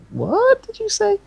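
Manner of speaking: very high, slow mock disbelief; baffled. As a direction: squeak it slowly like a baffled listener. Imitating a baffled listener to accents.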